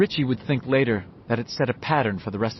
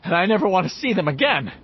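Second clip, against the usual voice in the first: winded, raised pitch; gasping; 7 semitones higher than usual. A narrator's reading. Ben to his friends after the chase, gasping. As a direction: push it out on short breath. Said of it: "Ben gasped".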